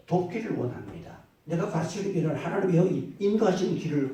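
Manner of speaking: loud, rising sermon delivery; preaching. Acted. Pastor preaching to the congregation, in a loud sermon voice.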